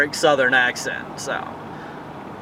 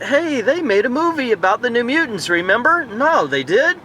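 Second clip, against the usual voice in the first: higher-pitched mock dialogue between two people; imagined casual conversation; 8 semitones higher than usual. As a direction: act out someone suddenly remembering, then surprised, pitched up. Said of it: "a conversation someone's gonna have with someone".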